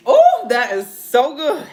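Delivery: loud slow savoring exclamation; delighted. Real, not acted. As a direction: moan with pleasure. Delight after tasting steak.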